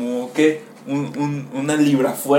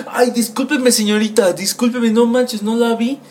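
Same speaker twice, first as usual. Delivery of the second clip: higher, hurried sorry-sorry voice; flustered apology; 8 semitones higher than usual. Retold or imitated. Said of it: "cuando vi eso dije". (disculpa nerviosa, con voz más aguda)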